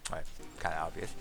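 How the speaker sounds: normal talking voice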